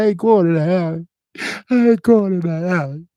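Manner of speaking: high, loud wailing refusal; crying refusal. Retold or imitated. Held up at gunpoint, refusing robbers through loud high sobs. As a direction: sob it out, terrified. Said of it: "I started crying".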